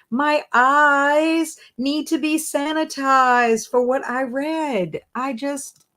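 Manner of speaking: high-pitched, emphatic pleading; exasperated protest. Real, not acted. Reader pleading she cannot quit the book, exasperated and high. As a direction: protest in dismay, leaning hard on key words.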